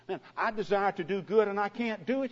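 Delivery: desperate apostle's outcry; anguished cry. Retold or imitated. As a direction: cry out in frustration. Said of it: "he cried".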